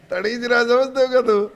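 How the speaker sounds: laughing through the words; amused, chuckling teacher. Real, not acted. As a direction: tease the student while laughing.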